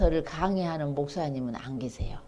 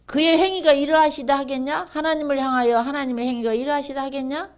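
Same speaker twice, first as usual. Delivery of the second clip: loud, high challenging questions; declaimed prophetic challenge; acted rebuke; 7 semitones higher, 8 dB louder than usual. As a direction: throw the question at them, rising at the end.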